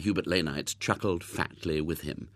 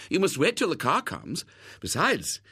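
lightly voiced hostess, pitched up; protesting; 7 semitones higher, 3 dB louder than usual. A narrator's reading. Missus Lane asking her guest to wait, protesting. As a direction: mildly insist that a guest stays. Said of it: "protested Missus Lane".

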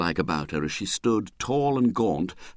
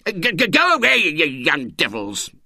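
put-on stammering angry old man, high; sputtering; 5 semitones higher, 3 dB louder than usual. Angry uncle at window sputtering at carol singers. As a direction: sputter with fury. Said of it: "he sputtered".

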